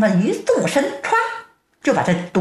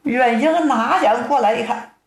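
usual speaking voice

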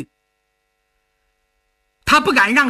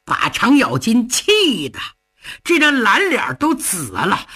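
ordinary delivery, nothing marked

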